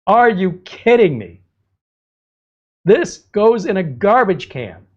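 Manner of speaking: real exasperation building at the junk listing; worked up. Real, not acted. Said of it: "Sorry, I'm getting worked up".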